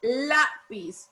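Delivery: "Lápiz" in two separated syllables, weight on LÁ; stress on LÁ. Shown as a demonstration. Teacher repeats for class to find the stress, "Lápiz" stressed on LÁ. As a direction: lean on LÁ in "Lápiz", then let piz fall. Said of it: "¿Dónde lleva el mayor fuerza de voz?".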